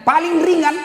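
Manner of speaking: pitched high on ringan; emphatic stress. Real, not acted. Preacher stressing that this is only the lightest fire. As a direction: cry out the word ringan.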